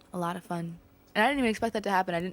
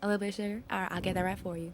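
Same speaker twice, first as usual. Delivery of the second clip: Southern belle accent; 5 dB quieter than usual. Shown as a demonstration. Southern belle serving lemonade, Southern accent. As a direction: play a Southern belle taking an order. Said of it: "and then a southern belle".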